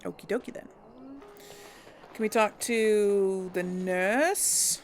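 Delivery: ordinary delivery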